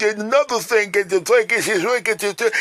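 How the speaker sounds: high, loud nagging whine; mock the pestering caller; hammed-up whining friend; whiny friend on speaker. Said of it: "and this idiot".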